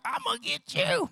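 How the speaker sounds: very high mock monster threat. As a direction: ham up the chasing big kid as a monster.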